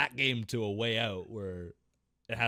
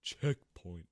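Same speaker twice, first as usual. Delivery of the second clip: sarcastic air quotes; 7 semitones lower, 4 dB quieter than usual. Real, not acted. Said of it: "in air quotes".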